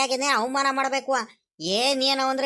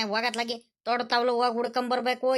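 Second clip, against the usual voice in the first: usual speaking voice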